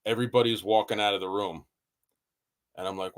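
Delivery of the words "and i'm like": usual speaking voice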